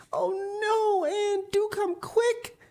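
high, tearful frightened plea, slowing; nervous pleading. A narrator's reading. Diana begging Anne to hurry, frightened and near tears. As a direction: beg her to come, voice near tears. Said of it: "implored Diana nervously".

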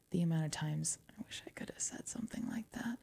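fast breathy aside; rushed wistful whisper under the breath. Real, not acted. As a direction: whisper it quickly to yourself.

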